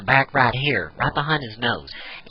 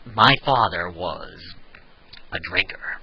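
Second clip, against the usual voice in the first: long pauses, pitch swooping then dropping off; Joker; 7 semitones lower, 4 dB quieter than usual. Shown as a demonstration. Demonstrating the villain impression, low and halting. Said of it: "with the Joker impersonation".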